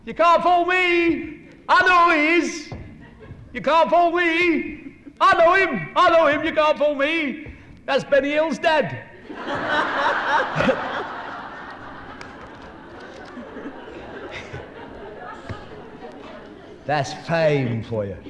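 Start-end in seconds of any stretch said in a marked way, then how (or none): 0.0-9.1 put-on high, loud, excited kid's voice; Manchester boy shouting. Manchester boy yelling at the stage door. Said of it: "And he's going". 9.1-16.8 laughing. Real, not acted. Laughing after the punchline.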